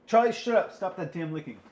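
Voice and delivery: high, loud snap at the dog; scolding the dog. Real, not acted. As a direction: yell at the dog to stop licking. Scolding his licking dog.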